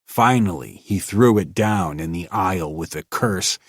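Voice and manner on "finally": usual speaking voice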